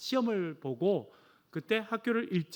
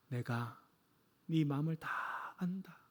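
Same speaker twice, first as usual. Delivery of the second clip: slow, lower whisper of God; gentle whisper; 3 semitones lower, 8 dB quieter, slower than usual. Retold or imitated. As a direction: whisper it tenderly into the heart. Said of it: "하나님께서 그렇게 말씀하신 것 같아요". (마음속에 들려오는 하나님의 느린 속삭임)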